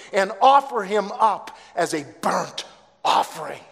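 decree it with full force; commanding God. Acted. God's command, end.

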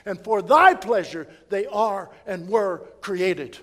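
loud, ringing pulpit voice; full-force preaching. Acted. Preacher thundering scripture to the church, loud and ringing. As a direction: preach it out big to the congregation.

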